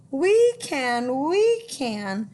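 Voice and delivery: sing-song storybook reading; reading to children. A narrator's reading. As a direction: read it like a picture book. Rhyming story narration.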